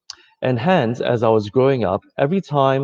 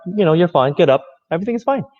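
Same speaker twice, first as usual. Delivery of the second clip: much higher, louder upbeat reassurance; brisk cheerful mom; 8 semitones higher, 4 dB louder, much faster than usual. Retold or imitated. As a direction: chirp it like a mom telling a kid to get up. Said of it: "the mom would say".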